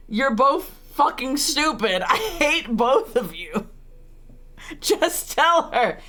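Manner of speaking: drawn-out exasperation breaking into laughter; exasperated. Real, not acted. Streamer scolding two game characters, exasperated.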